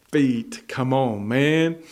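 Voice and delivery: normal talking voice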